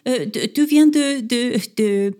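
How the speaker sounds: high, halting fake-French questioning; mock French accent. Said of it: "With a terrible accent".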